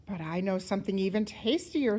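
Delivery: usual speaking voice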